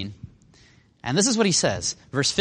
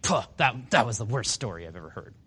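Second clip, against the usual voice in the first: lower-pitched, scoffing put-down; derisive mockery; 5 semitones lower, 5 dB quieter than usual. Pharisees making fun of Jesus after the story, derisive. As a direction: imitate a scornful dismissal, voice dropped. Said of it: "they just make fun of him".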